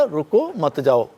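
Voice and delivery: slow "रुको", short break, "मत जाओ" together; pause after रुको. Shown as a demonstration. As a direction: let a comma be heard after रुको. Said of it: "रुको पे comma लग गया".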